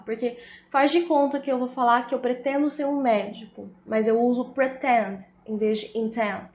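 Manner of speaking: normal talking voice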